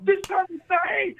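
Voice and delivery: normal talking voice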